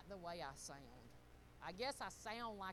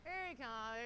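normal talking voice

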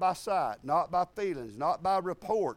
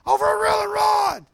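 shouted much higher and louder, outraged; furious shout; 13 dB louder than usual. Retold or imitated. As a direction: yell it like he can't believe it. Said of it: "he was really mad".

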